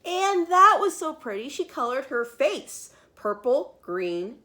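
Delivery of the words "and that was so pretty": high, loud sing-song gush; delighted refrain. A narrator's reading. Narrator gushing to young listeners, high and delighted. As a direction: gush over how pretty it is.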